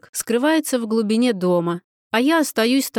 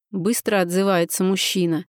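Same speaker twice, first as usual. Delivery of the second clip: plain reading voice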